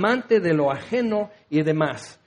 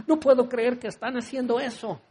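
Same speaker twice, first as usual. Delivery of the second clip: laughing disbelief; 4 semitones higher than usual. Acted. Prim young believer shocked.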